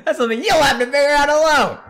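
high wailing outburst; hammed-up anguish. Character despairs of ever being happy, wailing. Acted breakdown and harsh reply.

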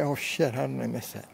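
ordinary talk

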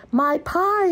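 high alarmed cry; exclaimed. A narrator's reading. Grandma remembering her pie outside, high exclamation. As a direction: gasp it out, suddenly remembering. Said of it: "exclaimed Grandma".

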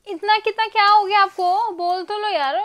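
loud, high, drawn-out exasperation; exasperated plea. Real, not acted. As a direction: demand an answer, stretching the words. Pleads with silent, sulking partner.

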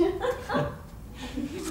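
gushing high hostess laugh; high trilling laugh. Acted.

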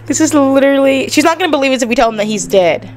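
panicked regret spilling out to viewers; anxious panic. Real, not acted. Regrets calling the widow.

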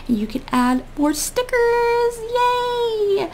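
high squealing joy; excited. Real, not acted. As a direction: squeal with glee. Thrilled about journal pages.

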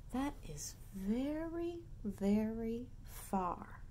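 slow, soft, drawn out; gentle slow awe; slow wonder. Acted. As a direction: stretch the far out sleepily.

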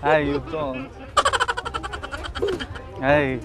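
normal talking voice

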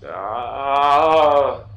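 loud, drawn-out "ah"; frustrated cry. Real, not acted.

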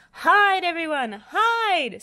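high, loud panicked yell; hammed-up fright, shrieking high; screamed warning. A narrator's reading. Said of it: "screamed Spud".

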